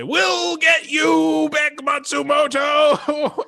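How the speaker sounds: high, loud, slow drawn-out shout; hammed-up cry of villains being swept away; sinking pirates' threat. Pirates vow revenge as they go down the whirlpool, long shout. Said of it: "as they go down".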